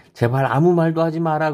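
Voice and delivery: preacher voicing an urgent plea; pleading command. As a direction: implore them as if giving an order.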